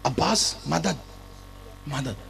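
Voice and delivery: a father asking his son quietly; quiet call. Acted. Ali quietly asks Abbas for help, soft low plea.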